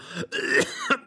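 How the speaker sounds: high rasping cough; cough, catching breath. A narrator's reading. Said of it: "der Greis hustete endlos".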